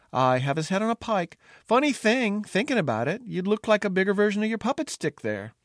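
the cook taunting the jester with a threat; head cook mocking the fool's head on a pike; menacing cook. Cook Bubble retorts menacingly.

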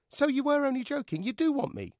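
high-pitched eager puppet voice; hopeful dummy. Acted. Dummy hopefully questions Joe.

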